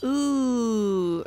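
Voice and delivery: normal talking voice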